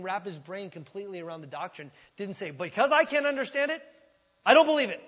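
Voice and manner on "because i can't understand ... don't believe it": loud, high, stubborn refusal; defiant outburst. Acted. Preacher voicing a doubter who rejects doctrine, loud and defiant. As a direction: throw it out like a flat rejection. Skeptic refusing what he can't understand.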